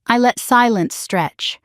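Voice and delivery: plain reading voice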